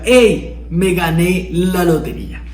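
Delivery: excited. Shown as a demonstration. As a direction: burst out with the good news. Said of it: "a que te diga".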